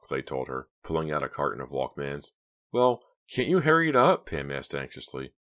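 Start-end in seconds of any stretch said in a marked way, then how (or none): 2.7-4.3 high, loud, anxious urging; strained with nerves; anxious, high-pitched. A narrator's reading. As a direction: hurry them along in a panicky squeal. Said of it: "her voice so high-pitched she didn't recognize it".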